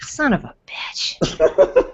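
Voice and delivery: under the breath, slow and toneless; muttered curse. Real, not acted. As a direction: grumble it to yourself. Can't find her Stormtrooper.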